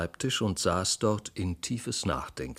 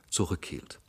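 ordinary delivery

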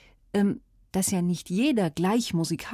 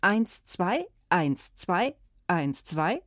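even "eins, zwei" pairs, each "zwei" rising; two-beat count; much slower than usual. Shown as a demonstration. Host showing children another walking rhythm, two-beat count. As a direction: count in steady marching pairs. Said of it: "Das geht übrigens auch".

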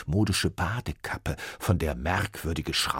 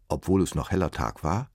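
usual speaking voice

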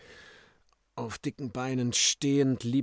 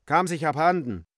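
raised, louder call; calling from inside; 6 semitones higher, 6 dB louder than usual. A narrator's reading. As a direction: call out through a closed door. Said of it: "Er rief von innen". (laut von innen gerufen)

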